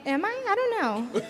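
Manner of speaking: high, playful feigned bewilderment; mock confusion. A streamer answering a troll in high mock confusion. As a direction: act innocently unsure, voice up high.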